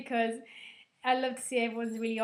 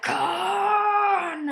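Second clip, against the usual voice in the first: loud, higher drawn-out yell; famous Khan yell; 9 semitones higher, 11 dB louder than usual. Retold or imitated. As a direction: bellow the villain's name.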